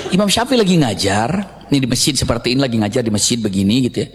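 normal talking voice

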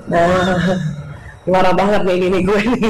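a hint of the husband, uneasy; husband's voice.

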